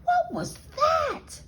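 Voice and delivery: startled squeak. A narrator's reading. Kitten startled by mirror.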